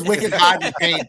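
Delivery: teasing imitation of a Bostonian; playful Boston "wicked" with dropped r's; Boston accent. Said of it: "Karen's got a Boston accent".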